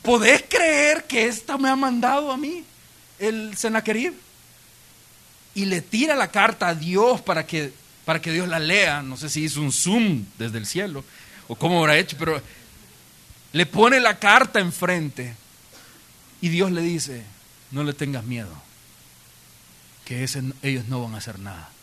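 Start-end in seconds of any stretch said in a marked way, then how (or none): 0.0-4.1 high-pitched incredulous question; indignant disbelief. Retold or imitated. Ezequías showing God the enemy's letter, high indignant disbelief. 17.7-21.7 low, soft divine answer; calm reassurance. Retold or imitated. God answering Ezequías about the enemy, calm and low.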